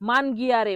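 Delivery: মানগিয়ারে with a hard g; mispronounced Italian. Shown as a demonstration. Teacher showing how people wrongly say it, mispronounced. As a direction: say মানগিয়ারে as man-GI-a-re. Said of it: "মানুষ যখন ভুল বলে".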